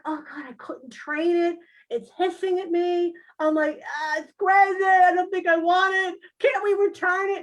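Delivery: high, loud frazzled whine; whiny panic. Retold or imitated. Begging the teacher to return the crazy cat, high whine. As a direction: fret like you're overwhelmed.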